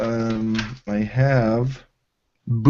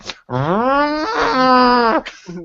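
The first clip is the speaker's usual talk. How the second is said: high, sustained car-exhaust whine; bumblebee muffler buzz; 13 semitones higher than usual. Acted. Player shows DM how the muffler sounds, high buzz. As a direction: imitate a buzzing little car muffler. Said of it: "sound more like a bumblebee".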